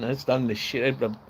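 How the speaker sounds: ordinary delivery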